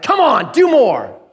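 high, pushy nagging at the dirt; half-joking impatience; mock exasperated urging. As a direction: prod it like a fed-up gardener.

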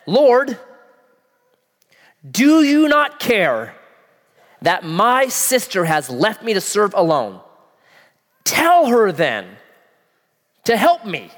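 exasperated plea, high and loud; complaining Martha. Acted. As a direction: whine it at Jesus like the overworked sister.

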